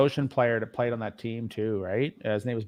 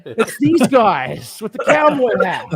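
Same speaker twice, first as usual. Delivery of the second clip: higher, louder and slow astonishment; amazed; 8 semitones higher, 8 dB louder, slower than usual. Retold or imitated. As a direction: relive his discovery, wide-eyed. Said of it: "I'm like".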